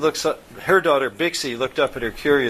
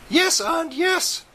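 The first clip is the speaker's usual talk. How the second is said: very high squeaky chorus voices; tiny chorus; much slower than usual. A narrator's reading. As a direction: chirp it like a swarm of tiny creatures. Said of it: "chorused the Orfids".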